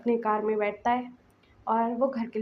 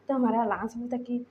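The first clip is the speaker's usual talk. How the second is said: usual speaking voice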